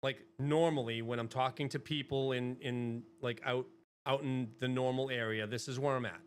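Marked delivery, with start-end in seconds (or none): none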